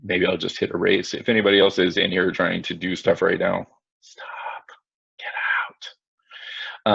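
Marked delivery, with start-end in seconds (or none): 4.0-6.9 barely voiced, very soft command; hushed aside. Real, not acted. Teacher to anybody trying to do stuff, hushed whisper. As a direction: whisper it under the breath, spaced out. Muttering at others interfering on screen.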